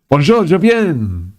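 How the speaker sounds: high, slow put-on French accent; hammed-up French, sung out high and slow; mock French. Vlogger breaks into mock French between game lines.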